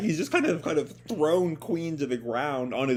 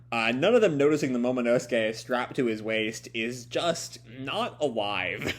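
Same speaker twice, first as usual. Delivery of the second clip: ordinary delivery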